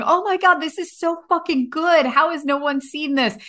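high-pitched, retold burst of amazement; amazed rave. Viewer on a plane raving to themselves about the film, amazed. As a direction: gush in disbelief, re-enacting your own reaction.

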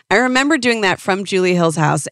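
usual speaking voice

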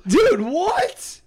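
high-pitched, rising disbelief; real astonishment. Real, not acted. Co-host reacts to a surprise result, astonished.

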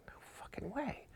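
whispered astonishment, rising at the end; hushed disbelief. Retold or imitated. Golfer spotting a celebrity in the distance, whispered disbelief. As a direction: whisper it as if you can't believe your eyes.

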